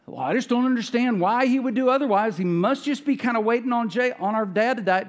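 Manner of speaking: voicing someone who just can't understand; acted bafflement. Brothers puzzle over Joseph's kindness.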